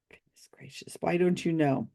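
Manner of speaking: exasperated whisper; whispered aside. Real, not acted. YouTuber mocks psychic's endless questions in a whispered aside. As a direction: whisper it under your breath.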